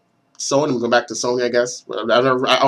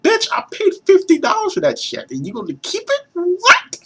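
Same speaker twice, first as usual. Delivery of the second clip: outraged high rant; 14 semitones higher than usual. Retold or imitated. Ranting at a friend who kept his game, high mock outrage.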